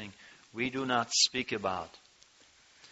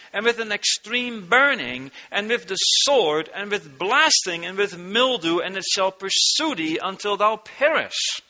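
preaching the curses; 8 semitones higher, 5 dB louder than usual. Acted. Preacher reading the curses of Deuteronomy to the flock, thundering. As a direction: pound each curse out. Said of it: "he says".

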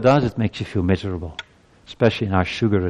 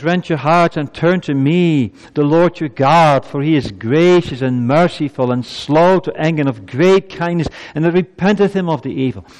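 raised, high preaching voice; impassioned scripture declamation; 7 semitones higher, 4 dB louder than usual. Acted. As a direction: proclaim the verse with rising fervor.

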